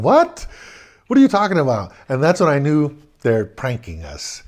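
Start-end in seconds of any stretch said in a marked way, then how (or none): 0.0-1.9 high, loud mocking laugh-talk; laughing disbelief. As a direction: scoff at them, much higher and louder. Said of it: "We started laughing at him".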